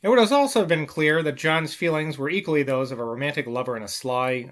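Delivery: plain reading voice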